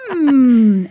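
long, loud suspicious hum; Keith Morrison. Acted. Co-host imitating the narrator's little sound effects, long suspicious hum. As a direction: draw out a pondering, doubtful hum. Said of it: "and it's like".

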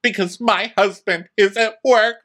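mock tearful wail. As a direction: wail it in a hammed-up high voice. Acted tearful wail as Candace.

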